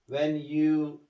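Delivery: ordinary talk, nothing marked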